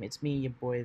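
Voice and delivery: normal talking voice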